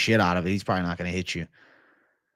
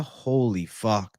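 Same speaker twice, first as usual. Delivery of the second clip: normal talking voice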